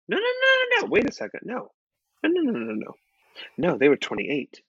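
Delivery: catching herself mid-story, no way; sudden correction. Real, not acted. Catches own mistake about ages.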